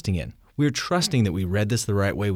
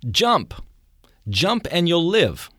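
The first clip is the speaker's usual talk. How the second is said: fire captain's bullhorn call; 9 semitones higher, much slower than usual. Retold or imitated. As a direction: call up to the seventh floor. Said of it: "And the fire captain says".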